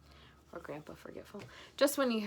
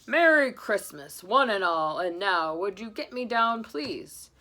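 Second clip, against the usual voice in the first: slow, loud booming greeting; Santa; 8 dB louder, slower than usual. Acted. Santa greeting the family, jolly. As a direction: boom it jolly.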